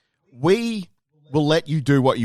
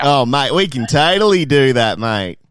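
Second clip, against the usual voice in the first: put-on loud broad Australian accent; 2 semitones higher, 6 dB louder than usual. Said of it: "that is not what Australians sound like".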